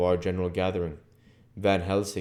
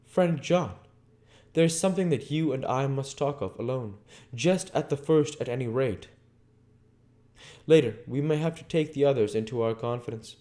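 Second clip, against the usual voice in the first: put-on raised, earnest old Dutch professor; a higher, urgent foreign scholar confiding; Van Helsing voice; 8 semitones higher than usual. A narrator's reading. Van Helsing confides in his friend John, earnest and raised.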